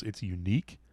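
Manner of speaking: usual speaking voice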